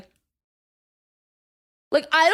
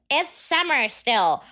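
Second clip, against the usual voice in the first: mocking girl's voice; slower than usual. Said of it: "One girl tried to be like".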